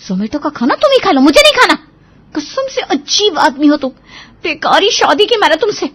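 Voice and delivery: high, loud bickering; indignant marital tirade; angry wife. Acted. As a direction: throw the offer back at him. Wife quarrels with husband.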